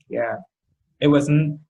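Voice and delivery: ordinary delivery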